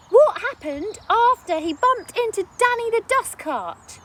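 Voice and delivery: put-on high, loud little boy questioning; boy eager to hear what happened; curious child. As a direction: ask eagerly like a young boy. Child asking about story.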